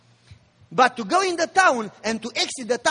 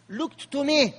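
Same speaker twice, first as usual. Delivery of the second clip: ordinary delivery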